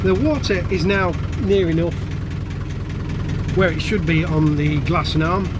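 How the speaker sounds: blustery outdoor recording; wind on mic. Real, not acted. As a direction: wind roaring over the mic. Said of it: "Sorry about the wind noise".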